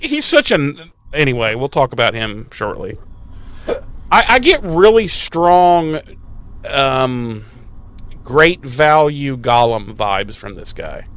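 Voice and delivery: ordinary delivery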